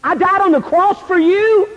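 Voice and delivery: high, indignant rebuke; disgusted. Acted. Jesus rebuking a teenager face to face, disgusted. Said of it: "would be the word disgust".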